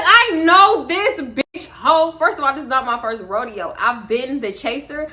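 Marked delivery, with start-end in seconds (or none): 0.0-2.2 drawn-out slow disbelief. Retold or imitated. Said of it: "I'm like".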